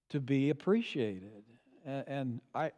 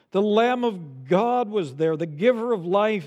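louder, emphatic pulpit exhortation; forceful preaching; 4 semitones higher, 7 dB louder than usual. Real, not acted. Preacher pointing his congregation to Christ, forceful preaching. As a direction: drive the warning home to the congregation.

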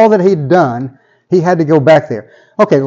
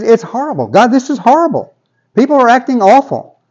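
high-pitched, worked-up grumbling to God; complaining prophet; 6 semitones higher than usual. Acted. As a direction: act out an exasperated complaint. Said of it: "He's complaining".

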